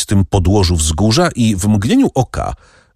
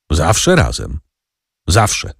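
measured, pointed echo ending on a rise; understated meaningful questioning; slower than usual. A narrator's reading. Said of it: "wymownie i pytająco".

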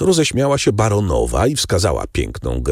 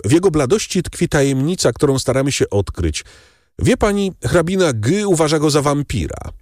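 lightly voiced Morcerf; 13 semitones higher than usual. A narrator's reading. Morcerf on the count's pallor.

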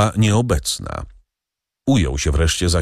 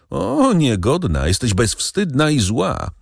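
theatrical pathos; 7 semitones higher than usual. A narrator's reading. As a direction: wail O, niegodna like a wronged matriarch. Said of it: "z patosem niezwykłym".

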